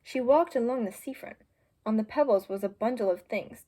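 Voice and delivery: ordinary delivery, nothing marked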